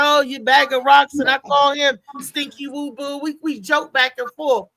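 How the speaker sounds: ordinary delivery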